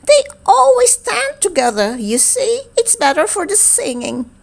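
high little beetle voice. Acted. Beetle complaining about the frogs, high and small. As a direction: play a tiny gossipy beetle.

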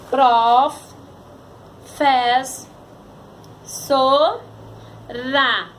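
"Prof, fez, so, ra" with a long pause after each; sílabas separadas. Shown as a demonstration. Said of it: "separação de palavras". ("Prof, fez, so, ra" sílaba por sílaba, com pausas)

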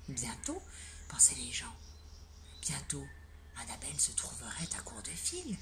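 soft hushed whisper; low secretive storytelling whisper. A narrator's reading. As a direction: whisper what the townsfolk thought. Narrator whispers again.

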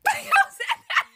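high, slow, laughing question; laughing disbelief. Real, not acted.

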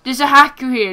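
alarmed. Real, not acted. Spots hacker mid-game, alarmed.

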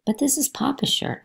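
complaining. Acted. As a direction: complain like a child who hates the shirt. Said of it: "I complained".

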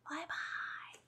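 breathy whispered bye-bye, very soft; whispered goodbye. Shown as a demonstration. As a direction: breathe the farewell out softly. Farewell dropped to whisper.

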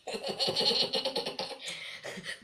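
nefesli, sesi çıkmayan kahkaha; sessiz kıkırdama. Real, not acted. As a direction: sesizce içten kıkırda. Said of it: "Nasıl bir gülüş?". (sessiz kahkaha)

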